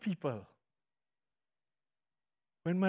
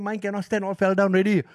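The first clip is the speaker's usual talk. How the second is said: higher, louder joking retort; joking reply; 3 semitones higher, 6 dB louder than usual. Retold or imitated. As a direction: toss off the punchline with a laugh in it.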